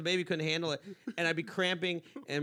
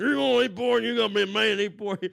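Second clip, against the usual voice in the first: loud, raised macho-dad voice; macho man; 6 semitones higher, 10 dB louder than usual. Retold or imitated. Dad daring son to eat pork, macho goading. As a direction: goad like a hammed-up tough guy. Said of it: "He was the macho man".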